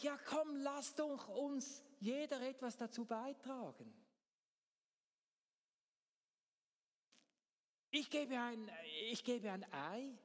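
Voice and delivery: high, chirpy voice of a cheerful hen; bright-eyed hen with a merry plan. Acted. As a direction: propose it gleefully, pitched well up. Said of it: "das Huhn sagt so ganz fröhlich".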